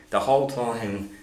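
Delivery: ordinary talk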